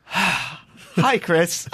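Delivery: sigh, then greet the nuisance caller; weary sighing greeting. Retold or imitated. Desk lady answering yet another call, exasperated. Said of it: "now it's one of these".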